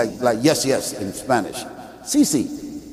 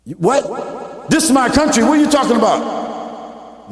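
mock indignant surprise; 4 semitones higher, slower than usual. Immigrant told to go home, mock indignant surprise. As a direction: act shocked, this is my country. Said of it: "All of a sudden you say".

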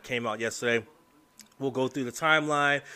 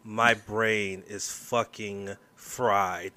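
normal talking voice

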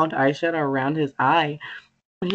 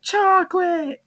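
put-on high, slow, strained old woman wailing chocolate; hammed-up frail mother crying out; mock old mom cry; slower than usual. Friend mocks the shriveled mom from SpongeBob, hammed-up high wail.

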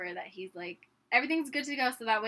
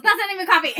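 high, loud mock snap as dad; annoyed dad; 8 semitones higher, 15 dB louder, much faster than usual. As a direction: imitate the father's indignant rejection. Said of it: "He was so annoyed".

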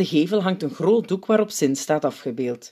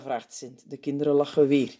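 ordinary delivery, nothing marked

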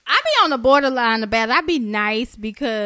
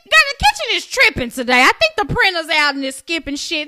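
sweet server excuse; 5 semitones higher, 6 dB louder than usual. Retold or imitated. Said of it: "Every time the kitchen didn't".

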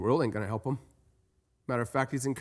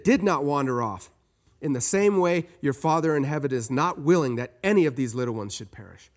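scripture reading; 5 semitones higher, 5 dB louder than usual. Acted. Reads Matthew 18 aloud.

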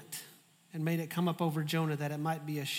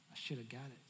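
muttered resentful aside; 6 semitones lower, 15 dB quieter, faster than usual. Retold or imitated. Inner resentful thought.

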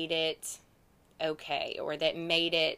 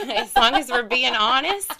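laughing confession, louder; 7 semitones higher, 12 dB louder than usual. Real, not acted. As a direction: laugh through the line sheepishly. Laughing confession about Vegas.

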